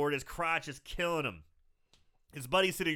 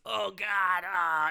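pained groan; 4 semitones higher, 4 dB louder, much slower than usual. Retold or imitated. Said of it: "He's bending over, just".

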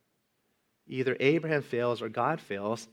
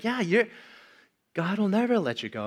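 emphatic reassurance; 9 semitones higher, 5 dB louder than usual. Acted. Voicing God's reassurance.

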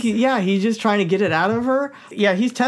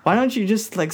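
usual speaking voice

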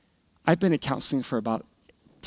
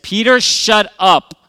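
loud, higher, drawn-out reprimand; angry father's yell; 7 semitones higher, 11 dB louder, slower than usual. Retold or imitated. Father to noisy son during TV time, angry yell. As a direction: yell it slowly at a misbehaving child.

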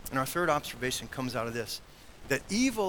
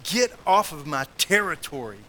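loud, slow, heavily stressed defiance; acted contempt; 6 dB louder than usual. Preacher voicing demon confronting Jesus, loud slow contempt. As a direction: push each phrase out as a contemptuous demand. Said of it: "You can almost hear the contempt in his voice".

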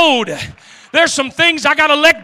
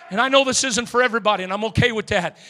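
usual speaking voice

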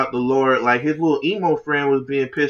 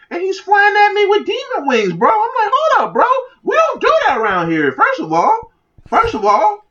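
high-pitched, hammed-up alarm; mock-panicked street guy objecting; outraged protest; 4 dB louder than usual. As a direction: protest in comic disbelief, voice shooting way up. Acted outraged gangster confronted by ninja.

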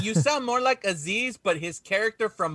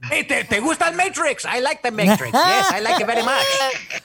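put-on Spanish accent; 7 semitones higher, 6 dB louder than usual. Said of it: "Freddy's like".

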